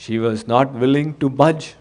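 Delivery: ordinary talk, nothing marked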